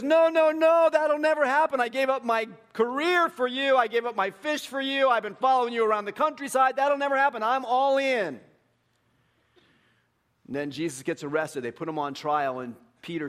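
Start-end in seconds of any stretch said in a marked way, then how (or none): 0.0-8.4 loud, high insistence of loyalty; acted outburst of indignant devotion; impassioned protest. Peter protests Jesus' prediction.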